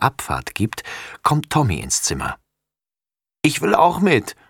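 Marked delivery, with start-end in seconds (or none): 3.4-4.4 higher, lightly voiced sniffly boy; sniffly little brother. A narrator's reading. Little brother begging to come along, sniffly. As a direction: plead it like a child with a cold. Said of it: "schnieft er".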